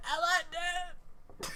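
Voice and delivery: high, slow cartoon-kid voice; Gohan. Son agreeing to watch with dad, high drawn-out voice. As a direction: stretch it out in a high boy squeak. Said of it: "And he's like".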